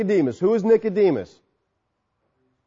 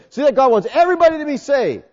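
higher, louder, fast smug paraphrase; mocking quote; 7 semitones higher, 4 dB louder, faster than usual. Preacher mimicking those who misread Timothy, mocking. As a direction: parrot the opponents' claim with a smirk. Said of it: "They say".

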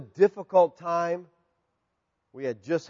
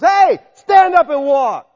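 commanding shout, higher and louder, slowed; Paul's command; 9 semitones higher, 11 dB louder, slower than usual. Acted. Preacher acting Paul calling to the lame man, big slow shout. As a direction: bellow it slowly like a command to rise. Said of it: "he goes".

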